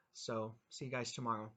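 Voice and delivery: ordinary delivery, nothing marked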